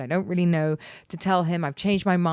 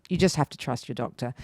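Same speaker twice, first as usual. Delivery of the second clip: ordinary talk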